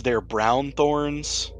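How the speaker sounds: normal talking voice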